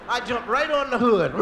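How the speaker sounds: ordinary delivery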